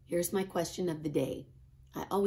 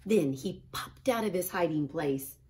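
usual speaking voice

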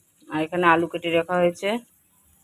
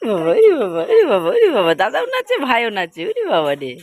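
excited cooing; 3 dB louder than usual. Real, not acted. Excited, cooing at dancing kids.

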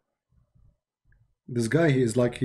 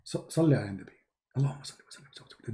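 mock prayer, rushed and much softer; rote muttered prayer; 3 semitones lower, 6 dB quieter, faster than usual. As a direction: rattle it off under your breath like rote. Mocking callers' rote repeated prayer.

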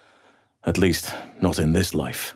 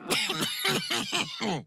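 cough; 4 dB quieter than usual. Acted. Cough sound.

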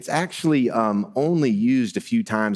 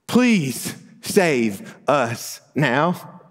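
slow, drawn-out, higher plea; acted shout; 4 semitones higher, much slower than usual. Crowd calling to Jesus riding down the street, slow mock shout. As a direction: stretch each word like a restrained cry to the king. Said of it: "but you might shout".

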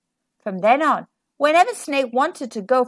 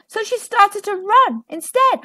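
plain reading voice

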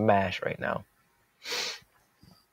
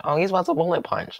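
higher, fast mock rival voice; mimicking opponent; 8 semitones higher, faster than usual. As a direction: rattle off the rival's guess. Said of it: "He's like".

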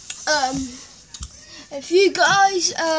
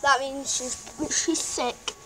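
usual speaking voice

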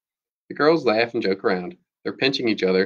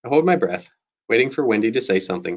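usual speaking voice

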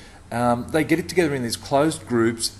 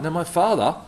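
ordinary delivery

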